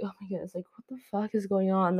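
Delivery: ordinary talk, nothing marked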